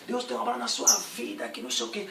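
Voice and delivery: lightly high mock prophecy voice; a hint of the pastor's prophetic voice; pastor prophesying. Retelling the pastor prophesying over him, lightly high. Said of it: "Ela falou assim".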